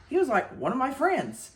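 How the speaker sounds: ordinary delivery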